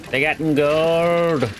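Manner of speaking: long, drawn-out, amazed; delighted surprise. Real, not acted. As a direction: stretch it out, thrilled to find gold. Finds gold after the blast.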